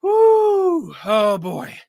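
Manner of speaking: high, loud celebratory whoop; excited whoop. Real, not acted. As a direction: let out a joyful cheer. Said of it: "But I'm excited".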